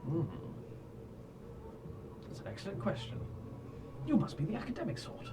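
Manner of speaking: low-voiced NPC. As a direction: speak low and knowing. GM voicing NPC Sol.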